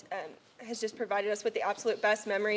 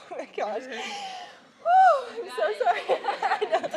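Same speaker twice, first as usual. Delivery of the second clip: higher, teary laughing woo, then apology; overcome with emotion; 9 semitones higher, 5 dB louder than usual. Real, not acted. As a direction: laugh through tears at the coach. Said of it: "I'm about to get really emotional".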